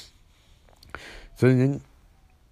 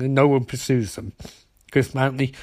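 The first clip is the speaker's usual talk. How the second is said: ordinary talk, nothing marked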